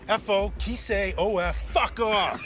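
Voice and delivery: spelled out slowly; slow hint. Shown as a demonstration. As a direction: drag the letters out as a hint. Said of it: "Something like this".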